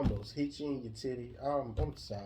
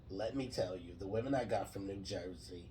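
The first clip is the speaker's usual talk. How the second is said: exaggerated Jersey voice, pitched down; New Jersey accent; 5 semitones lower, 5 dB quieter than usual. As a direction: confide in a hammed-up Jersey accent. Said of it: "they would sound like this".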